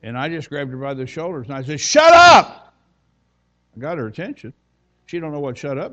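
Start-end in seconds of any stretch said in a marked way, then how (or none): 1.8-2.7 sudden high, very loud yell; explosive shout. Retold or imitated. Preacher grabs a screaming woman by the shoulders, explosive retold shout. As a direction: blast it out to get someone's attention.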